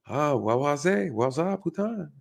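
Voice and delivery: lightly imitated French smoothness; a hint of silky French accent; smooth French. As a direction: cuss smoothly in mock French. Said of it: "he said it with the French smoothness".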